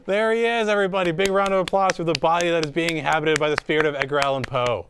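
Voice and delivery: ordinary talk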